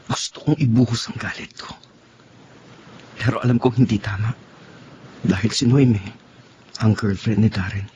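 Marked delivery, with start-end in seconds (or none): 0.1-1.7 whispered inner anger; whispered thought. Acted. Jealous man confessing his anger to himself, whispering. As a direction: hiss the jealous thought under your breath.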